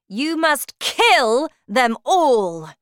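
slow, loud commanding witch queen; shouting witch. Acted. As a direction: bellow like a terrifying witch queen. Witch gives orders.

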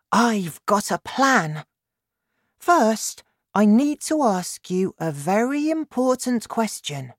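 put-on high-pitched little girl with a plan; eager girl. A narrator's reading. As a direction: put the question high and keenly, as a child. Narrator voices Sophie.

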